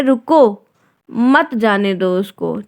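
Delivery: रुको as its own command, then slow मत जाने दो उसको; pause after रुको. Shown as a demonstration. Someone stopping a person leaving, pause after रुको. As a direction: halt after रुको, then draw out the rest. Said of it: "तो कोई कह रहा है".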